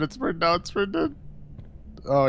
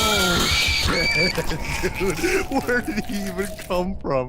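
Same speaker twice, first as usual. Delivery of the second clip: shocked laughing; 7 semitones higher, slower than usual. Real, not acted. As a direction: cackle in shock at the surprise. Friend reacts to sudden monster.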